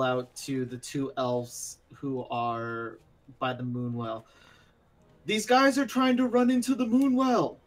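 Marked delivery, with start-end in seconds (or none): 5.3-7.6 loud, high call across the clearing. Acted. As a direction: shout a warning to the elves. Calling out to distant elves.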